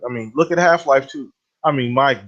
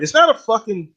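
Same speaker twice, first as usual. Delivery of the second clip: exasperated rant; 7 semitones higher than usual. Real, not acted. Ranting about delayed game release.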